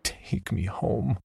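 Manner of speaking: weak, slow croak. A narrator's reading. Narrator begging the stranger, a slow weak croak. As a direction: barely croak it out, pleading. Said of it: "I croaked out".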